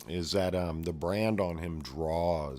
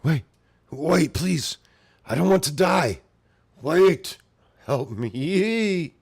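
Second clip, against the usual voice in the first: high, loud desperate begging; pleading for life; 11 semitones higher, 10 dB louder, slower than usual. Acted. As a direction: beg not to die, panicked. Dying apostle pleads for life.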